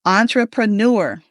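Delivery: "Entrepreneur" as ON-truh-pruh-NUR, both ends stressed; model pronunciation of entrepreneur. Shown as a demonstration. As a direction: lead a class in "Entrepreneur", opening with "on". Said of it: "let's say that together".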